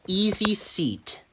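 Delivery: ordinary delivery, nothing marked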